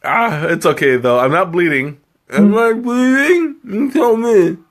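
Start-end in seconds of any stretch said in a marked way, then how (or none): 2.3-4.6 playful worried. Real, not acted. Checking tongue, asking chat.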